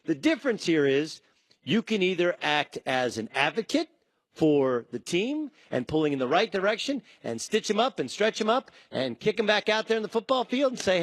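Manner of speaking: usual speaking voice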